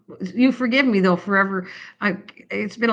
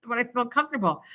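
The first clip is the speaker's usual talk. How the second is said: pleading excuse; 5 semitones higher, 5 dB quieter than usual. Retold or imitated. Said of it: "And then I'd go".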